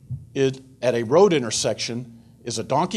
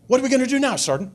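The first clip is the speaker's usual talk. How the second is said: eager asking; 10 semitones higher, much faster than usual. Retold or imitated. Marines eagerly asking their sergeant what's next, excited. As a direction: pitch it up like an excited young Marine.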